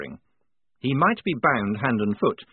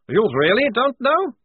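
high, loud incredulous question rising on know; amused disbelief; 14 semitones higher, 7 dB louder than usual. A narrator's reading. Prisoner to clueless lieutenant, amused and incredulous. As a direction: relish the officer's ignorance. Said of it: "Now Towering was laughing".

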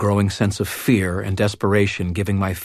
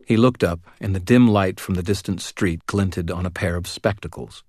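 plain reading voice

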